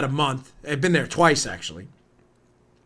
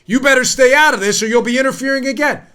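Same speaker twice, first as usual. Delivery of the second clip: higher, louder mock threat; mock warning; 6 semitones higher, 5 dB louder than usual. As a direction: imitate someone warning others off. Acted warning from foreign liberals.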